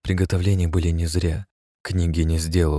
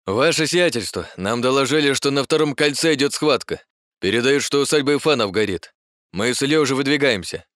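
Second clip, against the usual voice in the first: high, hurried, agitated report; flustered report; 9 semitones higher than usual. A narrator's reading. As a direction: rush it out breathlessly. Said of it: "сбивчиво заговорил штабс-капитан".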